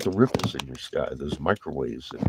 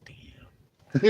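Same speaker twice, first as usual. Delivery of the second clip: awed whisper; whispered damn; 6 dB quieter than usual. Retold or imitated. Sailor reacting to spotting a submarine, a hushed whisper. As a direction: breathe it out in disbelief. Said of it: "I was like".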